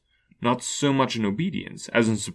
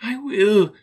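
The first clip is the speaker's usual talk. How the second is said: put-on frightened old Scrooge; 12 semitones higher, 5 dB louder than usual.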